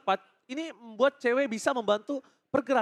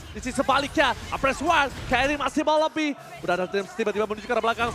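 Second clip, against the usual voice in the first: ordinary delivery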